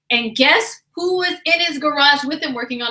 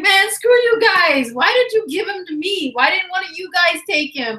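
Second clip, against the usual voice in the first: mock outrage; 7 semitones higher than usual. She scolds coworkers who stuck her with the bum, mock indignant. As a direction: whine at the friends who set you up.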